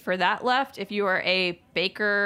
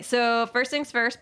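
ordinary talk, nothing marked

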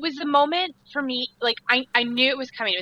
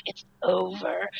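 resigned despair; 3 semitones lower, 5 dB quieter, slower than usual. Retold or imitated. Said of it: "you're just like".

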